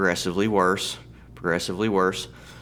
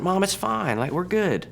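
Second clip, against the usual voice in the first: breezy reassurance; 11 semitones higher than usual. Retold or imitated. Son brushing off mom's cancer news.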